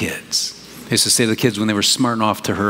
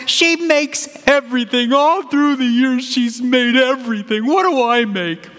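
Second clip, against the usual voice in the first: high, slow, whiny woman voice; high, drawn-out complaining wife; wife's envious lament; 13 semitones higher, slower than usual. Retold or imitated. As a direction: mimic a wife moaning about her gifted sister. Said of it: "she's like".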